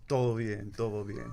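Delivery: relaxed native Spanish, todo bien rolled easily; Spanish accent. Real, not acted. Guest answers host's greeting in a Spanish accent. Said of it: "Listen to that accent".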